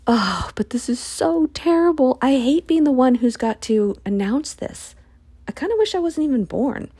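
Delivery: high, despairing complaint; dramatic lament. Retold or imitated. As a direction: moan it like the burden is too much. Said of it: "now he's gonna go".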